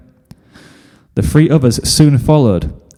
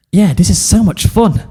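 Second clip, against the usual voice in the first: Sweetie Belle, pitched up and bright-eyed; high filly voice; 8 semitones higher than usual. Acted. To her friends at the party, a high cheerful filly voice. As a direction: chirp it like a little filly.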